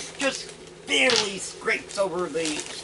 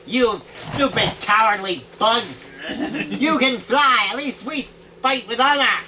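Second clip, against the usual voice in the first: scornful tirade; 5 semitones higher, 4 dB louder than usual. Acted. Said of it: "And the Scorpion goes".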